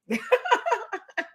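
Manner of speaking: squealed laugh sliding down; high falling laugh. Real, not acted. Cracking up after singing the Dreamgirls line, high laugh. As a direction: laugh high and let it fall.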